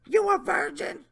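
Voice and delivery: high mock leer; Jeff Stacy voice. Reading the predator's question, high mocking voice. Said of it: "my Jeff Stacy voice".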